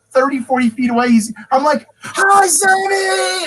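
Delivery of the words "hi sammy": a long, loud, high shout across the distance; calling out. Retold or imitated. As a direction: yell his name after him. Calling out to Sammy far off.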